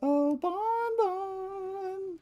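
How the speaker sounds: very high, drawn-out cartoon call; Funtime Freddy. Streamer imitating a game character calling Bon Bon, high character voice. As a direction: stretch each word way up high. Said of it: "the voice for Funtime Freddy is so good".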